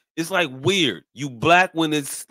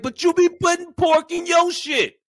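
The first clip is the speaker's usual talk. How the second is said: much higher quoted voice; retold challenge, pitched way up; 12 semitones higher, 4 dB louder than usual. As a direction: mimic her calling someone out.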